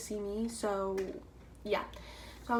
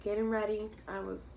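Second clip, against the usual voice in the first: ordinary delivery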